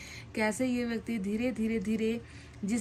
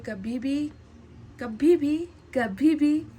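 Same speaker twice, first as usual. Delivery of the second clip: कभी भी repeated, punched louder each time after the first; emphatic stress; 4 semitones higher, 7 dB louder, slower than usual. Acted. Reading a partner's message of never forgetting, emphatic. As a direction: hammer each कभी भी with a pause between.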